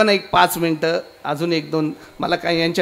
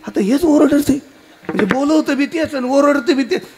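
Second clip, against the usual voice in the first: put-on high, aggrieved little boy; acted indignant child; child's protest; 6 semitones higher, 4 dB louder than usual. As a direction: protest your innocence in a raised, high voice. Child protests he was called.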